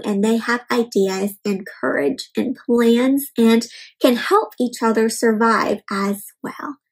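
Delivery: ordinary delivery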